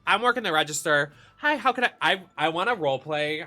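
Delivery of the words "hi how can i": bright, high shop greeting; customer service voice. Shown as a demonstration. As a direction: greet like a cashier. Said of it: "I wanna put on my customer service voice".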